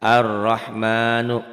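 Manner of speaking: Ar-rahmanu chanted slowly and loudly, doubled r; recited Arabic example. Shown as a demonstration. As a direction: recite Ar-rahmanu with a long rolled r. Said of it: "lamnya nggak dibaca". (Ar-rahmanu dibaca pelan, r ditasydid)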